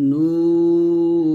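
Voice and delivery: নুন held long on the ন with a nasal hum and a stopped end; drawn-out নুন sung as a recitation; three-beat stretch. Shown as a demonstration. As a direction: stretch নুন for three counts, then close it. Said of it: "নুতে আমরা তিন টান দিয়ে নুনটাকে সাকিন করে ফেলবো".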